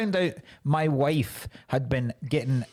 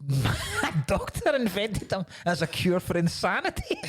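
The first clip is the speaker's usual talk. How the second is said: higher, cracking up mid-joke; laughing through words; 6 semitones higher than usual. Real, not acted. Comic telling a butt plug joke, laughing through it.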